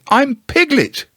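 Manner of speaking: high, insistent Piglet voice; insisting Piglet. Acted. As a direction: insist on who he is. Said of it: "said Piglet loudly".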